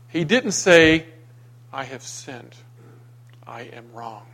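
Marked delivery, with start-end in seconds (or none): 1.7-4.3 quiet, low-key admission; humble confession. Acted. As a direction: own up softly, pausing between the two statements. Voices the confession Cain withheld.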